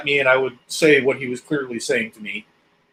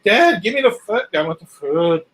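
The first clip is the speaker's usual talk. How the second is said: high, pleading cat voice; put-on lolcat whine for food; Samson; 3 semitones higher than usual. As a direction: beg like a hungry pet cat. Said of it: "Samson would be like".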